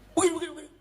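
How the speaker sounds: high, rattled-off telling-off; mock scolding. Husband going on at his silent wife, mock angry. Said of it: "Marahilah istri kita".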